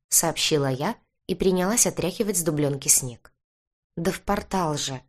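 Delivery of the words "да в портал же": patiently repeated explanation; mildly long-suffering reply. A narrator's reading. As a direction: spell it out with restrained patience. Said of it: "терпеливо повторил он".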